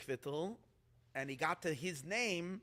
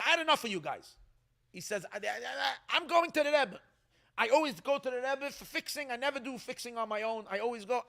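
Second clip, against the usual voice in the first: exasperated villager; 6 semitones higher, 5 dB louder than usual. Retold or imitated. Villager protests, going to Rebbe.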